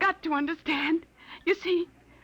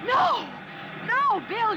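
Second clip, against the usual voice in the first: high panicked scream of no; terrified shout; 10 semitones higher, much slower than usual. Acted. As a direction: scream at him to stop. Car heading at the man.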